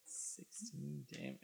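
soft unvoiced whisper. Real, not acted. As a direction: breathe it quietly. GM whispers damage.

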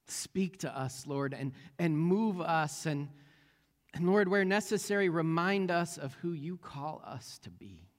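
reverent, hushed pastor's prayer. Acted.

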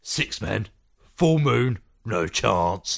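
British actor impression, punched in the middle; Sean Pertwee accent. Co-host asks for a redo, Sean Pertwee's British accent. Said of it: "Do it in a Sean Pertwee accent".

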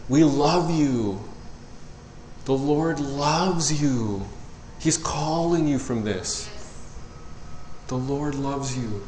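slow, soft, tender plea; tearful love. Pretend. Speaking to lost people with love, slow tender plea. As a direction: say it slowly as if near tears. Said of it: "with tears in your eyes".

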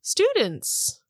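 high-pitched, taken-aback question; incredulous question. Acted. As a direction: say it high, as if caught off guard. Character startled at being asked for lessons.

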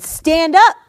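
sudden loud, punchy order. A narrator's reading. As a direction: call it out loud like a healing command. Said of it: "called to him in a loud voice".

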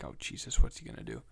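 whispered worried aside. Retold or imitated. Son wondering what his dad will do, whispered alarm. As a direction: breathe it out in alarm.

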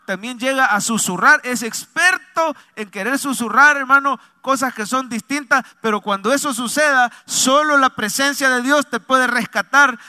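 forceful pulpit exhortation; fervent preaching. Acted. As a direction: drive the promise home to the congregation.

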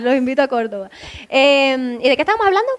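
normal talking voice

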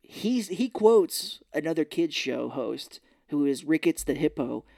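ordinary talk, nothing marked

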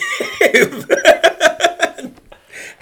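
high loud burst of laughter; laughing. Real, not acted. Comedian cracking up at his own punchline.

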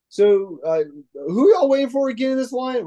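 high-pitched put-on casual questioner; Rooker. Actor quizzing fans waiting in line, high imitated voice.

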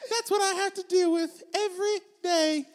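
playing Abigail. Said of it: "You're Abigail Adams".

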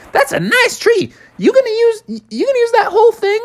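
very high squeaky beaver voice; eager little beaver eyeing the tree; Bucky the beaver. Acted. Bucky the beaver asking the frogs for the tree, squeaky.

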